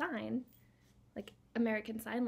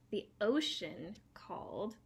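ordinary talk, nothing marked

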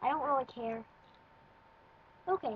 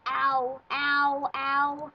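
a touch of mock pain, slightly louder ows; pretend pain; 5 dB louder, much slower than usual. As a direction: fake being hurt. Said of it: "Pretend that I'm Dorka the Exploder".

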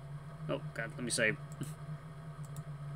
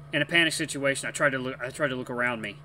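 ordinary delivery, nothing marked

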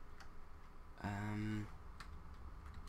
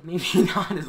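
startled laugh; 9 semitones higher, 12 dB louder than usual. Real, not acted. Sudden danger, laughs.

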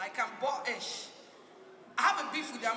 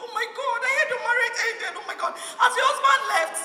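much higher and louder, mock-shocked gossip; nosy gossip; 9 semitones higher, 9 dB louder than usual. A nosy person asking about her broken marriage, high mock shock. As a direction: gush with fake concern.

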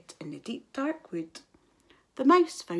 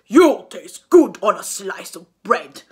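a big hungry beast; Gruffalo voice; 13 dB louder than usual. A narrator's reading. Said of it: "the Gruffalo said".

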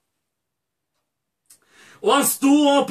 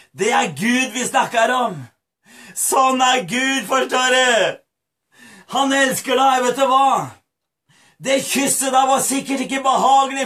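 ordinary talk, nothing marked

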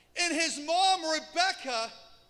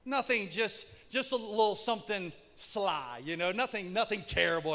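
usual speaking voice

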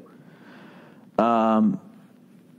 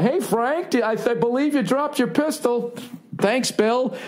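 much higher, politely chipper suburban neighbor; cul-de-sac suburbanite; 9 semitones higher than usual. Retold or imitated. Comic mocks his own frozen reaction, suburban neighbor voice. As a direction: play a clueless polite dad from the suburbs, pitched up. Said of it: "Just classic cul-de-sac".

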